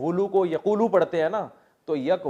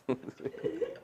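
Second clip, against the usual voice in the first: laugh; 14 semitones higher, 11 dB quieter than usual. Real, not acted. Laughs at own story.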